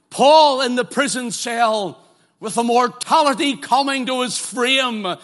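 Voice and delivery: fervent preaching. Acted. Preacher telling the online audience it's not about them, fervent. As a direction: press it home to the crowd.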